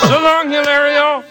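mock tearful shout.

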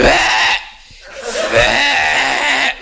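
high shrieking aunt's wail; mock shriek of horror, very high; shocked shriek. Comic imitates his aunt shrieking at the tattoo. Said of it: "she flips".

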